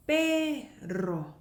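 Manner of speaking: "pero" slowly, split before a trilled "rro"; rolled r. Shown as a demonstration. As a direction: hold "pe", then roll into "rro" for "pero". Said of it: "굴리는 발음인데".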